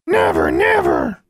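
very high, slow shrieking bird cry; raven squawk. A narrator's reading. The raven Charlie refuses to stay, screeching. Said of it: "Charlie repeated".

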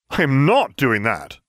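high, slow, emphatic refusal, a restrained shout. A narrator's reading. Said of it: "I shouted".

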